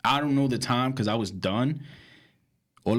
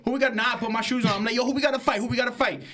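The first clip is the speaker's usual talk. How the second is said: put-on drunk fight challenge; 11 semitones higher, much faster than usual. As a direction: pick a fight, way too drunk.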